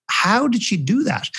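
high, slow, astonished question; amazed disbelief. Retold or imitated. Coach recalling the workshop woman, slow high amazement. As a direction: drag out the wonder at her. Narrator's amazed inner thought.

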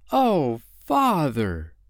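high, drawn-out reproachful exclamation; exclaimed. A narrator's reading. Wendy seeing her father cheat, exclaiming. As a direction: cry out, starting high and falling. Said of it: "Wendy exclaimed".

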